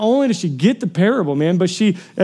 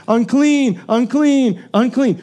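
higher, evenly repeated call; retold public warning cry; 5 semitones higher, slower than usual. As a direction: call it out word by word. Retold cry required of these women.

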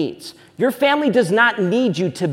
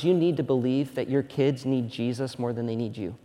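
ordinary talk, nothing marked

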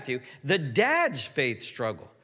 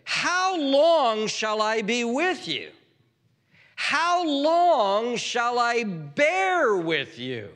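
high, very slow exasperated cry; holy frustration; 7 semitones higher, 4 dB louder, much slower than usual. Acted. Jesus asking how long he must bear with them, frustrated. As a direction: drag out each question in acted exasperation. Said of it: "there's a holy frustration here".

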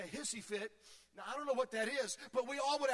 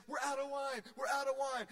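mock panicked cry; 3 semitones higher than usual. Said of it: "screaming and crying".

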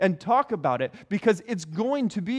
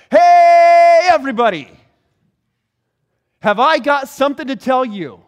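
calling out; 6 semitones higher, 15 dB louder than usual. Acted. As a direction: holler it high and long, like hailing passers-by. Peter calling out to crowd.

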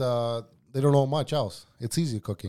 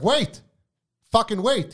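higher, louder snapped yell to back off; impatient shout; 9 semitones higher, 4 dB louder, slower than usual. Retold or imitated. Cook yelling at a waiter to wait, impatient shout. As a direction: shout them down mid-rush in the kitchen.

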